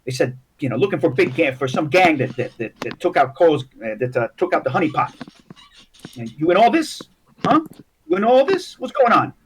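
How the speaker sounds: nervous, placating halfling babble; rattled little gangster, pitch rising on questions; scared halfling gangster. Acted. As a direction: back down anxiously while explaining the trouble. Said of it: "they're kinda, like, scared now".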